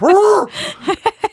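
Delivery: very high wordless yelp; mock pained howl. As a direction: let out a high hammed-up howl. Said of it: "And I'm like".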